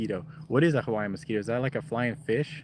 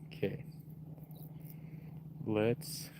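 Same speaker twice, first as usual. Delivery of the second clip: ordinary talk, nothing marked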